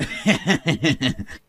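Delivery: derisive chuckle; taunting laugh. Acted. Husband taunting his wife about the car, taunting laugh. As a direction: laugh to needle her.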